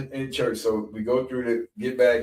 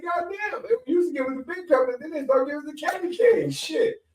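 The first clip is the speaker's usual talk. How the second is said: much higher, whiny complaining; griping kid. Retold or imitated. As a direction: sulk like a cheated kid. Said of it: "And I'm like".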